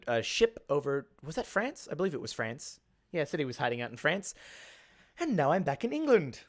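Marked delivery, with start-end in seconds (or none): none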